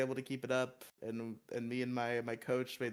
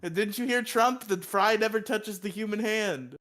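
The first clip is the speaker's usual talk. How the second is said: mock outrage; 8 semitones higher, 6 dB louder than usual. Continued mock outrage rant.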